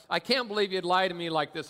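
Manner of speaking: ordinary talk, nothing marked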